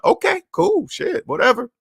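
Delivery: high-pitched, dismissive brush-off; mock nonchalance. Listener humoring a friend bragging about his new car, mock nonchalance. Feigned indifference to a friend's boast.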